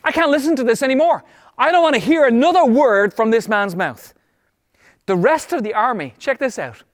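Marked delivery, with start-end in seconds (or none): 0.0-4.1 higher, fast burst of exasperation; fed-up outburst. Acted. David reacting to the giant's taunts, fed-up outburst. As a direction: blurt it out, unable to take more. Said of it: "He's like".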